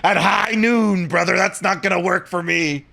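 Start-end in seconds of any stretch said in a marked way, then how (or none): 0.0-1.3 slowed, laughing Hogan-cowboy growl on brother; Hulk Hogan western. Pretend. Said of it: "Western accent ... turns into Hulk Hogan".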